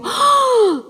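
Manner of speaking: sharp high gasp of delight; imitated gasp. Lecturer mimicking fans hearing the name, high excited gasp. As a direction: gasp like a thrilled fan. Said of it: "people either go".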